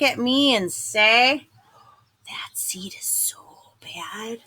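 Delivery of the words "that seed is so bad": unvoiced slow whisper; whispered gossip. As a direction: whisper it behind his back. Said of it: "they mumble".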